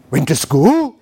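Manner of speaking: mock teen stating the blindingly obvious; pointed, incredulous child's reply. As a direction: answer as if the question is stupid. Child answers parent's obvious question.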